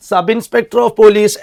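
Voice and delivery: each word of "Sub Inspector of Police" said slowly and clearly; careful dictation pace; slow spelled-out reading. Shown as a demonstration. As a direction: spell out the full form slowly for the class. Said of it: "ഇത് ഉച്ചാരണം നമ്മൾ നോക്കുക".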